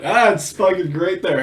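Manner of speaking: usual speaking voice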